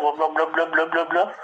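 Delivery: high-pitched blabbering; mocking gibberish. Listener mocks the caller's unclear speech, high babbling.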